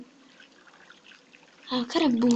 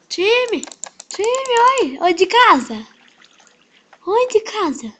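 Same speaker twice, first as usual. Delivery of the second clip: loud call out across the map; calling from far away; 7 semitones higher, 6 dB louder, slower than usual. Real, not acted. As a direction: call out to get attention. Calling a player for a team.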